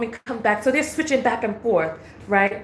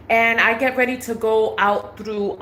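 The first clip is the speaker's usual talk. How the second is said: usual speaking voice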